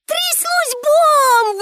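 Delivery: very high, drawn-out exclaim. A narrator's reading. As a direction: stretch the cry way up high. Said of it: "вигукнула Пепі". (дзвінко вигукує, дуже високо)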